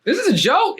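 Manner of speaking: very high rising question; astonished, questioning squeak; incredulous. Retold or imitated. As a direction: retell it in high-pitched disbelief. Retelling his disbelief at idol.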